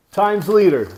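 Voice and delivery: high, loud declaring; triumphant answer. Real, not acted. Fan naming the newspaper, high and loud. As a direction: announce it proudly.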